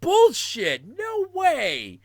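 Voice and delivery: high-pitched, loud incredulous outburst; reenacted shrieking refusal to believe it; utter disbelief. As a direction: cry out in hammed-up disbelief. Retelling disbelief about Joker casting.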